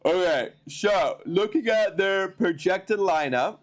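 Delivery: talking through a yawn. Real, not acted. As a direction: yawn mid-sentence. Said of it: "excuse me for the yawns".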